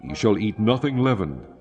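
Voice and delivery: ordinary talk, nothing marked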